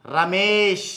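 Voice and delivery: రమేష్ drawn slowly on a long 'mee'; రమేష్ slow and soft, ending in 'sh'; pronunciation demo. Shown as a demonstration. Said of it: "ఇలా పలుకుతాం".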